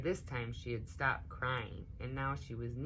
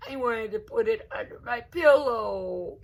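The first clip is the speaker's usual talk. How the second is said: high, loud tearful wail; crying child; 7 semitones higher, 11 dB louder than usual. A narrator's reading. Little girl crying to her cousin over the lost tooth, tearful. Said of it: "Rosa began to cry".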